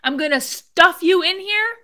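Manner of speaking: outraged mock quote of the cop; mock threat. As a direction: throw his threat back at him in disgust. Mocking officer's threat.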